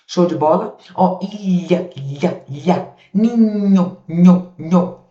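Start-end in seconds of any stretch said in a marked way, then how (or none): 3.1-5.0 "nho" said louder, stressing the single nh sound; digraph sound demo. Shown as a demonstration. Teacher sounding out the nh digraph for the class. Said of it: "duas letras com apenas um som".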